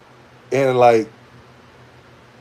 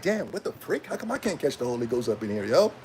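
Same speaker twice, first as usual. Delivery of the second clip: much softer jealous grumble; muttered complaint; 3 semitones lower, 11 dB quieter than usual. Retold or imitated. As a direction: grumble it under your breath.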